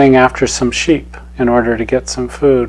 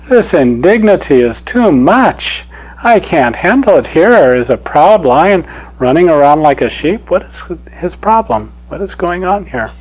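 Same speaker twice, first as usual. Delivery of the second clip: indignant lion; 4 semitones higher than usual. Acted. Proud lion scolding a lion acting like sheep, indignant. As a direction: scold him like an offended king of beasts. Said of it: "It says".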